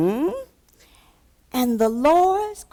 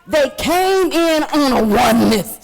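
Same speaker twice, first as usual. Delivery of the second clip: raised, impassioned church preaching; full-force preaching; 5 semitones higher, 5 dB louder than usual. Acted. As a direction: drive each phrase home to the congregation.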